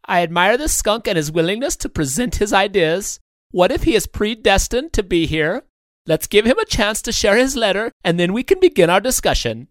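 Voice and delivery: warmth and humility. A narrator's reading. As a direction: appeal humbly on the skunk's behalf. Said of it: "with more warmth and humility in his voice".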